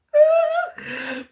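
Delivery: loud high burst of laughter. Real, not acted. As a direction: crack up laughing. Laughing mid-performance.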